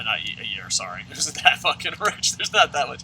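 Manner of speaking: usual speaking voice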